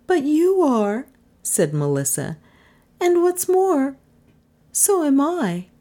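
high-pitched female character; reply well above the narrator's pitch; Melissa's voice. A narrator's reading. Melissa countering Trent, high character voice.

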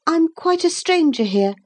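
put-on high-pitched small boy's voice; polite boy. A narrator's reading. Boy introducing himself to soldiers, politely.

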